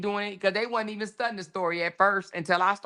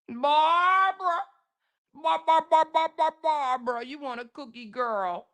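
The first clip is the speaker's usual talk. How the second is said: put-on high, coaxing baby talk to a pet; talking to a dog; 3 semitones higher than usual. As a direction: coax her like a puppy. Mocks commenter like a pet.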